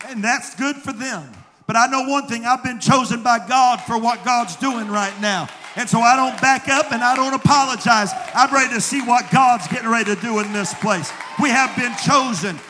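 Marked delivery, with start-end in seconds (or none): 1.7-12.6 louder, firmer sermon voice; building preaching. Acted. Pastor tells the congregation he won't apologize, building preaching. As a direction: rise back into preaching.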